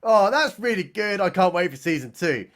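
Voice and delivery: normal talking voice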